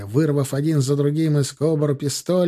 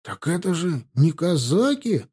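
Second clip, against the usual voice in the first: ordinary delivery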